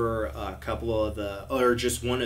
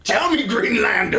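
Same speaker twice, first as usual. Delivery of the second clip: high, loud hammed-up hero voice; mock-epic challenge; 7 semitones higher, 7 dB louder than usual. Warrior challenging a Greenlander, hammed-up mock-epic voice. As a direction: call them out like a film warrior.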